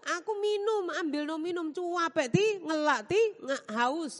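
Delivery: lady of the house whining to her maid for water; whiny tired employer. Retold or imitated. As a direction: moan for a drink, worn out. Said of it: "lalu bilang".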